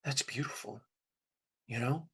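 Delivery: soft and hushed, nearly under the breath; quietly, as if thinking to himself; hushed inner thought. Retold or imitated. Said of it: "I just remember thinking".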